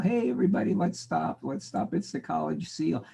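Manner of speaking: high, chirpy mascot greeting the class; cheery seal mascot. Pretend. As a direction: play a goofy seal mascot, bright and high. Imagined college seal mascot speaks.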